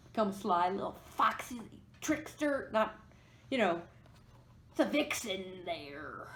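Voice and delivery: slipping into a pirate accent. Real, not acted. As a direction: growl it like a pirate. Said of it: "I don't know why I sound like a pirate".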